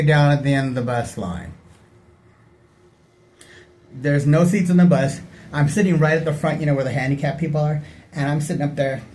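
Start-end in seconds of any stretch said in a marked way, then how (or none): none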